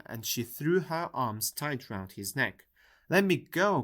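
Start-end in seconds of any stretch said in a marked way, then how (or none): 3.1-3.8 raised, pleading shout to be let go; loud cry. A narrator's reading. Boy crying out as the woman grabs him, loud and high. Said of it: "cried Oliver".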